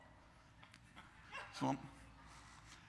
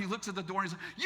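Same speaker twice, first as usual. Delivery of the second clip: ordinary talk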